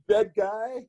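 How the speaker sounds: high-pitched imitation of an awed buddy; Bill Paxton. Actor imitates old friend leaning back on the couch, high-voiced impression. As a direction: mimic a friend's hushed-up disbelief, pitched high. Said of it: "the only way Bill can do it".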